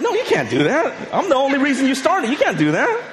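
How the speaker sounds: high, laughing mock outrage; husband protesting to his wife, high, half laughing; playful mock protest. Protesting wife watched without him.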